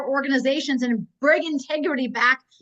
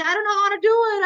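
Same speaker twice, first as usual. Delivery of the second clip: high-pitched mocking imitation of an excuse-maker; mock helplessness; 7 semitones higher, 3 dB louder than usual. Mocking people who make excuses.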